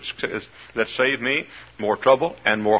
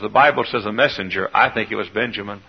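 ordinary talk

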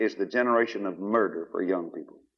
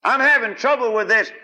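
higher, louder whining complaint; mock complainer; 11 semitones higher, 6 dB louder than usual. Church member cries out a trouble to preacher, whiny. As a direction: cry out the trouble like a fretting member. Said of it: "That's an inside job".

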